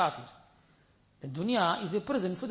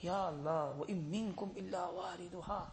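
hushed fearful recitation; 9 dB quieter, much faster than usual. Retold or imitated. Weeping companion recites verse fearfully.

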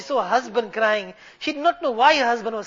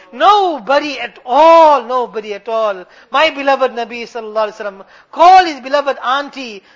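fervent supplication swelling on key words; urgent pulpit oratory with ringing calls; impassioned preaching; 7 dB louder than usual. Real, not acted. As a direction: plead with Allah before the congregation. Preaches throughout.

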